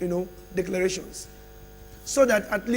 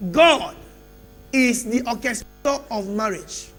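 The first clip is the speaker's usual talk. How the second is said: loud, rising preacher calls with long pauses; preaching at full force; 3 semitones higher, 8 dB louder than usual. Acted. Preacher rousing the church with loud calls. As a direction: pound each phrase out to the church.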